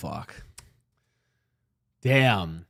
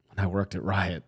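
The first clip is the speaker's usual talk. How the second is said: deflated; 6 semitones lower, 7 dB quieter than usual. Retold or imitated. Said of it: "thousand yard stare".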